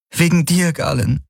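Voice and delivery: high-pitched, on the verge of tears; tearful confession. A narrator's reading. She grabs his arm and confesses, tearful. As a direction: confess it through welling tears. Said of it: "weitere Tränen liefen".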